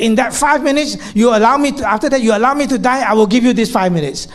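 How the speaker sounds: high, loud challenging demand; patient setting terms with the therapist; defiant bargain. Retold or imitated. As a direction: bargain hard, daring him. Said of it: "And she looked at me".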